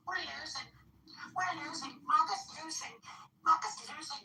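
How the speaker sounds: very high, whiny parody; mocking whine. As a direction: mimic him like a panicking child. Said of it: "you come in here and you're like".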